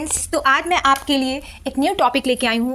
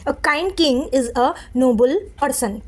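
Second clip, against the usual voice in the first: slow reading; much slower than usual. Shown as a demonstration. Teacher reading example sentence slowly for students. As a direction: read A kind king is a noble person very slowly. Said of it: "example".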